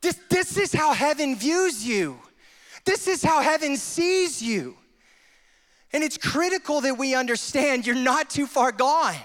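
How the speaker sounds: loud, high, fervent sermon voice; full-force preaching. Acted. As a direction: preach it out with fire.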